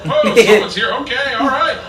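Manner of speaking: high, loud flustered reaction; startled onlooker. Acted. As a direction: act caught off guard, then awkwardly accepting. Acting out embarrassed onlookers.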